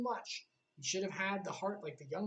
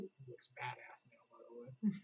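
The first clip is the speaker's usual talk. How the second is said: hushed, voiceless aside; whispered aside; 9 semitones lower, 12 dB quieter than usual. Real, not acted. Commenting to viewers on Riku's new look, whispered aside. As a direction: lean in and whisper it like a secret.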